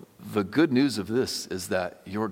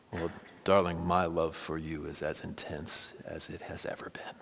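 soft, hushed loving reply; tender whisper; 3 semitones lower, 6 dB quieter than usual. Pretend. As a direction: murmur it to your wife like a vow.

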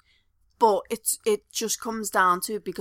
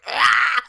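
mock exasperated shout; 7 semitones higher, 7 dB louder than usual. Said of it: "She's like".

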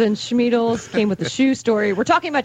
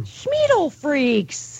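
slow drawn out; 4 semitones higher, much slower than usual. Pretend. Repeats the show name slowly.

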